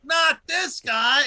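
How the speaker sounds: put-on high, slow, laughing child's whine; kid voice. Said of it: "And I'd be like".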